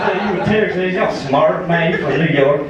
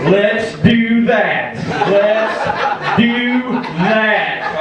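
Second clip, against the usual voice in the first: drawn-out catchphrase; 3 semitones higher, much slower than usual. Acted. Rusty caps his joke with a catchphrase, drawn-out drawl. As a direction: stretch each word out long for the crowd.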